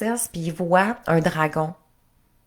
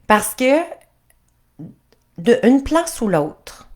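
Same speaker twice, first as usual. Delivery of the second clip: ordinary talk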